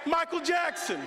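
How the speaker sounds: name shouted out high; called out name. Real, not acted.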